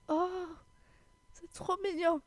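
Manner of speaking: high-pitched melting "aww"; real gushing over cuteness; cooing. Real, not acted. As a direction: coo it up high at something adorable. Melting at a cute moment.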